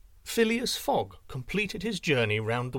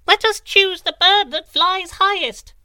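much higher, much louder squeaky wren cry; eager little wren; 11 dB louder than usual. A narrator's reading. Tiny wren eagerly urging the birds, high and loud. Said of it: "she cried even more eagerly than the others".

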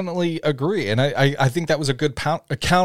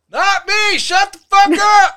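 very high, loud mock holler; drawn-out, strained comic yell; yelling from a distance; 7 dB louder, slower than usual. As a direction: shout back like an annoyed far-off neighbor. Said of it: "someone yells from a distance".

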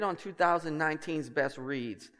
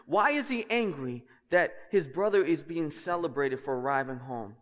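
usual speaking voice

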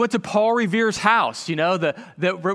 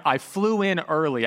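ordinary delivery

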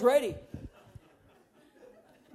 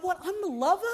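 mock bewilderment; 5 semitones higher, 3 dB quieter than usual. Comic confusion.

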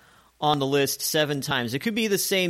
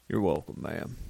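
very low, soft cowboy courtesy; low-toned country gentleman; low country-boy voice; 9 semitones lower, 8 dB quieter than usual. Shown as a demonstration. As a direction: drop it into a low, quiet tone. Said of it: "Get that low voice, get that low tone".

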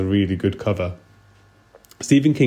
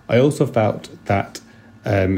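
usual speaking voice

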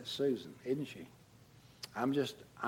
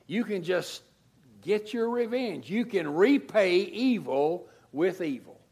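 raised, louder preaching voice, pressing the point; forceful preaching; 6 semitones higher, 6 dB louder than usual. Acted. Pastor urging the church against revenge, raised preaching voice. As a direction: preach it hard to the congregation.

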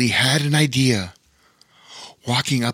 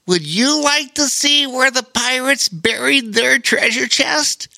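much higher little spider, proud and polite; a tiny spider introducing himself; friendly spider voice; 13 semitones higher, 3 dB louder than usual. Acted. Spider offers a surprise.

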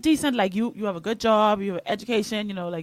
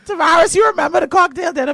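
calling out; 8 semitones higher, 10 dB louder than usual. Real, not acted. Calling out to a friend.

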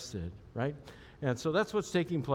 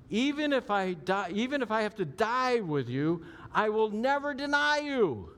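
higher and louder, swelling vow; vowing Peter; 7 semitones higher, 3 dB louder than usual. Retold or imitated. Peter vowing to Jesus he'd die first, high and rising. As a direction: promise it like nothing could shake you. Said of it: "He says, third time".

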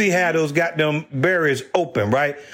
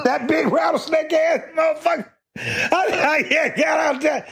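laughing outburst; 9 semitones higher than usual. Real, not acted. Laughing outburst at manager returning.

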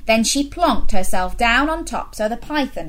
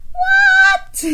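much higher, loud gasping what; astonished what; 8 dB louder than usual. Acted. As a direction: act amazed for the children. Teller reacts to the surprise.

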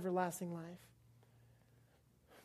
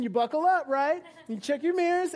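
normal talking voice

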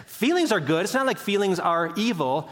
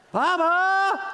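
put-on high, louder child's call; grandson calling; 13 semitones higher, 4 dB louder than usual. Grandpa imitates grandson calling through the house. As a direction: shout for grandpa like a lost little boy. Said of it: "desperate cry".

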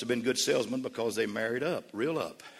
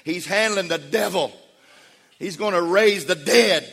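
loud and high, building to a shout; God bragging on his son at full volume; proud voice of God; 9 semitones higher, 12 dB louder, slower than usual. Acted. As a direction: shout it out like a proud father in heaven. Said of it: "The voice was saying".

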